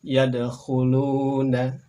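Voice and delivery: Yadkhuluna very slow, d bouncing; slow Arabic demo. Shown as a demonstration. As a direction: stretch Yadkhuluna out for learners. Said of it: "Berikutnya silakan".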